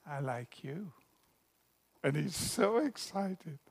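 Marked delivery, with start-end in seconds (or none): 0.1-0.9 shy murmur. Acted. Shy girl answers boy.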